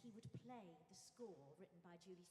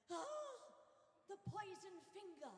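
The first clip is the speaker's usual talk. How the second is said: camp villain line; 9 semitones higher, 7 dB louder than usual. Retold or imitated. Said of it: "turned back to me and went".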